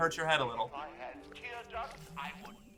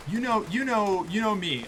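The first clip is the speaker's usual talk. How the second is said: high voice; 7 semitones higher than usual. Acted. Higher, far voice.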